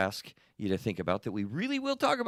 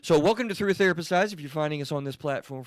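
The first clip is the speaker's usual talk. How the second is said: podcast host intro; 7 semitones higher, 4 dB louder than usual. Acted. Opening the podcast show.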